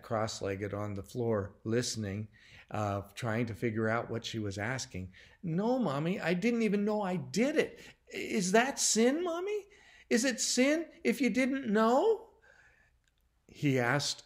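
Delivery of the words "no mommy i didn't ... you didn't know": put-on high, anxious little-boy voice; desperate questioning. A narrator's reading. Timmy asking Mommy whether it is sin, desperate. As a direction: plead for an answer, pitch climbing. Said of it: "he was desperate to know".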